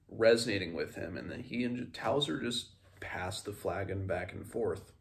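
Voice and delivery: ordinary delivery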